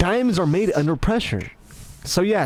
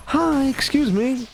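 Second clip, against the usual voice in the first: clearly higher, slower polite greeting; 7 semitones higher, slower than usual. Shown as a demonstration. Getting a girl's attention, high careful voice. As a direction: lift the voice, slow it, be gentle. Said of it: "I'm not used to".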